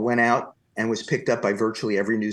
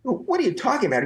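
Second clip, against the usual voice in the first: much higher, fast incredulous yell; hammed-up outrage throwing the reporter's words back; outraged echo; 13 semitones higher, faster than usual. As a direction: fling his question back in disbelief. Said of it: "began to scream at this man".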